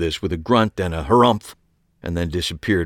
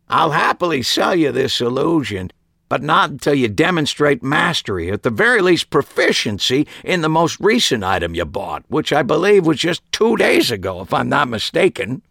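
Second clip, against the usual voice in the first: higher, lightly voiced stern old shopkeeper; a hint of a fussy old magician lecturing; old uncle, cranky magician; 6 semitones higher than usual. A narrator's reading. Harry voiced in story.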